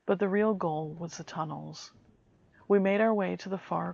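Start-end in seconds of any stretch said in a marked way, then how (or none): none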